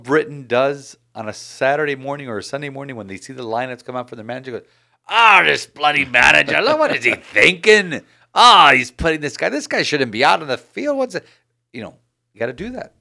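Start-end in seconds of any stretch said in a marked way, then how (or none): 5.1-11.3 put-on loud, ranting British fan accent; mock British accent, ranting loudly. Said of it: "How was that British accent?".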